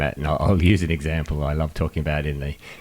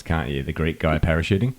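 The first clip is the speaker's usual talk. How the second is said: ordinary delivery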